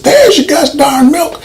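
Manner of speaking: hammed-up yell delivering the milk; mock angry yell. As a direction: bark it like an over-the-top action hero. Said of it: "comes back and says".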